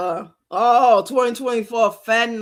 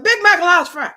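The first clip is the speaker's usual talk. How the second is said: higher and louder, finally blurting the order; forcing it out; 7 semitones higher, 7 dB louder than usual. Retold or imitated. High woman at the counter finally blurting her order. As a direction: push the words out in one burst. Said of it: "somehow I must have get it out".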